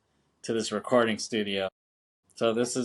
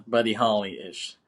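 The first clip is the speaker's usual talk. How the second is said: ordinary talk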